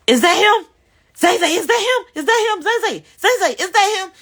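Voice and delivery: high, loud, urgent repeated question; frantic asking, voice way up; reliving the scare, high and loud; panicked. Retold or imitated. Panicked asking daughter about ex.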